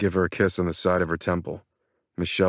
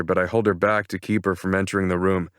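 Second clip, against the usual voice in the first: usual speaking voice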